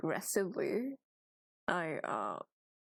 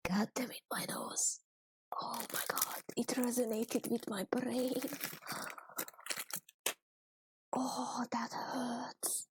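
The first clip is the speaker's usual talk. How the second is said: whispering in pain; 4 semitones higher than usual. Real, not acted. Nose pain after a sneeze.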